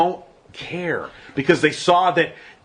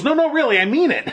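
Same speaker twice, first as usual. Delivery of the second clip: higher-pitched, amused imitation of someone insisting; mock insistence; 11 semitones higher, faster than usual. Congressman to laughing colleagues, mock-earnest insistence. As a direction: imitate a man protesting he's serious.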